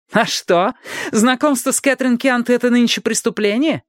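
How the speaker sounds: high-pitched challenging question; high, prickly girl's voice; defiant retort. A narrator's reading. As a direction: throw the accusation back indignantly. Pippa defiant under questioning.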